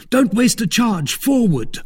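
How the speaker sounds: high-pitched shouted command; rally your companions under attack; urgent shout. A narrator's reading. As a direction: call the order out high and urgent. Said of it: "shouted D'Artagnan".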